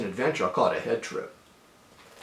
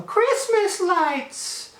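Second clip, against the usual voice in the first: put-on kid's awestruck cry; much slower than usual. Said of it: "and I go".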